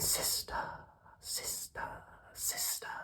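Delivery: faint whispered echo; echo from the cave. Acted. Cave echo answering the duck, whispered and fading. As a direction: fade the word away like a cave echo. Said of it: "a voice from the cave came back".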